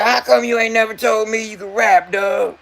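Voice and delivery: high, retold shout of disbelief; mock shout. Nephew shouts at his uncle for hiding his rapping, a retold high yell. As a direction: belt it out like he can't believe it. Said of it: "He goes".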